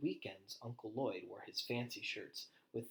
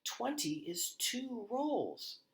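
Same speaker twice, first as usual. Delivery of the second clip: high, very slow explanation; excited child; 12 semitones higher, much slower than usual. A narrator's reading. Henrietta explaining to Nicholas, high and slow with delight. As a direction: spell it out, thrilled.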